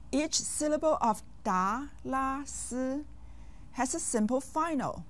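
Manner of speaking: ordinary talk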